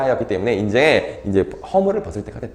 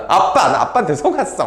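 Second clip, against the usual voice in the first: high-pitched mock reproach to dad; resentful complaint; 6 semitones higher than usual. Son blaming father for the arranged marriage, resentful complaint. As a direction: act out a wronged child complaining. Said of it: "엄청 원망했겠죠".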